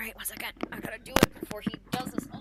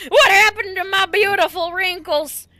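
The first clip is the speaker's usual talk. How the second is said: high, loud wail of dismay; aghast; 7 semitones higher, 10 dB louder than usual. Acted. Wizard mourns his lost wrinkles, higher and louder. Old NPC discovers he is young.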